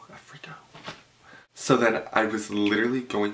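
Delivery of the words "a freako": breathy whispered freako. Shown as a demonstration.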